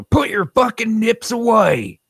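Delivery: high, slow, gruff cop line; cartoon cop voice. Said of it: "is also the cop on the cartoon".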